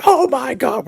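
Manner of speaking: fanboy losing it at the reveal; high burst of excitement; thrilled gasp. Retold or imitated. Reacting to Nick Fury reveal.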